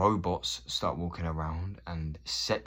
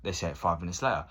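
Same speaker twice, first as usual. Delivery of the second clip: ordinary delivery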